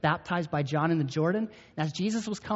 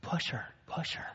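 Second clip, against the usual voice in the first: mock conspiratorial whisper, much softer; imitating a boy's whisper; 9 dB quieter than usual. Boy whispering to his friend to push the girl, mock whisper. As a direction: hush it like a kid plotting mischief. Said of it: "I see 'em whispering".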